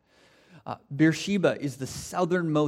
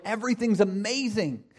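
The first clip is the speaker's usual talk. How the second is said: excited; 7 semitones higher than usual. Retold or imitated. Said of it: "and you're like".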